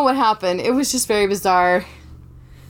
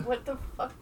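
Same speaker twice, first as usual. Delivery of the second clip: muttered far below usual level; off-mic aside; 16 dB quieter than usual. Real, not acted. Host rescuing cat from closet, quiet off-mic aside.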